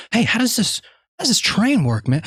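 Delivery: put-on childlike eager question; playful curiosity. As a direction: marvel at the toy train. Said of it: "I'm like".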